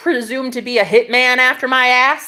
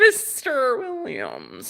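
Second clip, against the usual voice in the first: slow, drawn-out menacing Mister Williams; Matrix agent voice; 3 semitones higher, 8 dB quieter, much slower than usual. Pretend. Teasing a viewer by name in a slow agent voice. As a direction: draw out the name like the Matrix villain. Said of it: "You sound like that guy from The Matrix".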